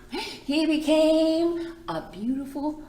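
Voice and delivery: high, slow and full of wonder; building wonder. A narrator's reading. Librarian revealing the butterfly to children, high and slow. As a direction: rise up as if unveiling a marvel.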